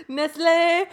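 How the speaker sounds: hammed-up French Nestlé; bad Joey-style French; fake French accent. Joking fake French after Joey joke.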